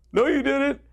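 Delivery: high, tearful mock sob voice; a caller weeping, retold high and choked; imitating a crying man. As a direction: squeeze it out like a man in tears. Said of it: "He's bawling".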